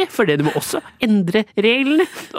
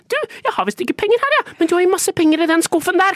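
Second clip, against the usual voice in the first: much higher and fast, wheedling; cheeky high pleading; 8 semitones higher, faster than usual. Pretend. Customer pleads with the cashier, high and fast. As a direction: rattle it off in a squeaky wheedle. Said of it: "sier du til den som sitter i kassa".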